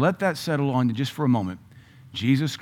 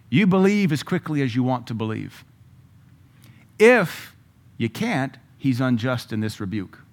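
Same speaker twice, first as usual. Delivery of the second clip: ordinary talk, nothing marked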